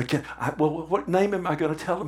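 ordinary talk, nothing marked

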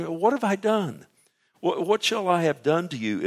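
high, pleading question; exasperated demand. Acted. Preacher as Job asking God what he did, exasperated.

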